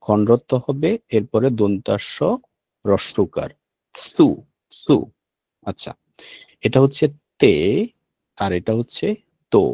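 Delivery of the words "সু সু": সু said slowly and clearly as a model; careful model reading of সু; teaching pronunciation. Shown as a demonstration. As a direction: repeat সু twice, carefully. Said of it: "দেখুন সু উচ্চারণটা কি হবে".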